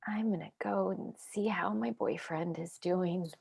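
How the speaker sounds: low, soft whispered exit; traumatized babysitter whispering goodbye; shaken whisper. Acted. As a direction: whisper it, barely holding together.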